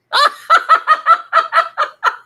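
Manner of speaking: high, loud cackling laugh; burst of laughter. Real, not acted. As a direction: cackle loudly at your own joke. Bursts out laughing at own joke.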